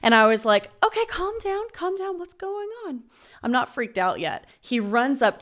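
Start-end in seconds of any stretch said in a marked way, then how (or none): 0.8-3.0 high-pitched, careful soothing; placating. Acted. Woman calming the roommate who burst in, high placating tone. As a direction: talk down someone unhinged, voice pitched way up. Trying to calm the intruder.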